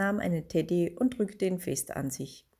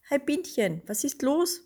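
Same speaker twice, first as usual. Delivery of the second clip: lightly voiced friend; 8 semitones higher than usual. A narrator's reading. Friend Marianne's voice.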